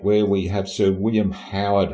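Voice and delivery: ordinary talk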